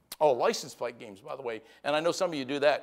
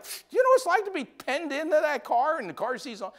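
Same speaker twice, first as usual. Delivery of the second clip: mock exasperated plea; 12 semitones higher, 3 dB louder than usual.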